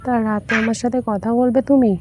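usual speaking voice

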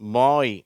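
பாய் loud, gliding to an "ee" ending; பாய் said emphatically for learners; model reading. Shown as a demonstration. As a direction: read பாய் as an isolated word, "boy". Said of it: "எல்லாமே ஈ அப்படின்னு முடி".

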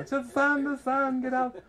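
high, loud mock panic; frantic screaming. Daughter warning swimmer out of the sun, high mock screaming. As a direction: imitate an urgent shrieked warning. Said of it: "started screaming".

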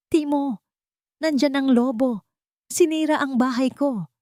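frightened plea. Acted. Panting Toti begs brother.

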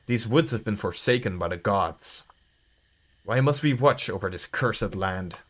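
grave, measured narrator read; hushed storyteller, sinking at phrase ends; story narration. Acted. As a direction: read it low and soft like a dark fable. Reads game's opening narration.

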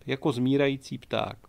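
plain reading voice

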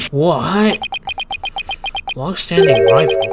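"Long-standing rival?" repeated in disbelief; incredulous echo. Acted. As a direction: throw "Long-standing rival?" back, stunned. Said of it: "When were we ever rivals".

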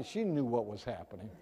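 ordinary delivery

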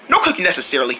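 normal talking voice

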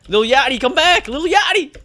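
loud high calling. Real, not acted. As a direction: shout for him to return. Calling a teammate loudly.

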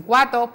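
"cuato" said without the r of cuatro; dropped r. Retold or imitated. Therapist imitating a child who leaves the r out of cuatro. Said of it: "en vez de cuatro dicen cuato".

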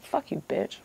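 soft, under-the-breath insult; muttered retort. Retold or imitated. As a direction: slip the insult out quietly, as an aside. Retold private retort to the lady.

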